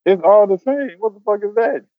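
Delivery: high, puzzled what-the-hell; baffled reaction. Retold or imitated. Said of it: "I was like".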